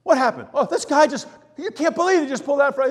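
mock outraged driver on the phone, fast at the end; road rage rant. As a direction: rant at the driver who cut you off. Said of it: "Just like this".

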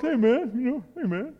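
a hint of a mellow buddy saying hey man; imitating brother-in-law. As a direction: imitate his easygoing way of talking. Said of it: "He kinda talks".

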